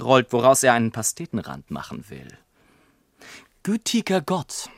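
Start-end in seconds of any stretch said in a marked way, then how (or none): 3.6-4.7 höher, ein Anflug von Entsetzen; leicht erschrocken aufrufen; entsetzter Ausruf. A narrator's reading. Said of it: "rief mein Vater". (entsetzter Ausruf, leicht gespielt)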